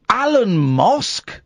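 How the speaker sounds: incredulous. Real, not acted. Host mocking the name incredulously.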